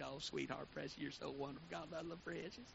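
lightly imitated soft sugary Southern lady; sweet Southern belle. Preacher lightly imitating the late bishop's wife, soft and sweet. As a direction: coo it gently like a sweet church lady. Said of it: "a sweet Southern belle".

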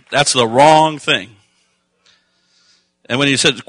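loud, driving preaching, slowing on key lines; full-force preaching. Acted. Preacher urging the congregation, loud and driving. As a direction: press the message home to the congregation.

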